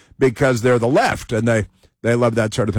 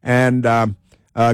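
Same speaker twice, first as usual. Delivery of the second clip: normal talking voice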